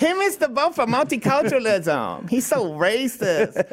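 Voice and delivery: lightly put-on, drawn-out mocking voice; mock dim-witted voice. Host mockingly voices a critic of the show, mock voice.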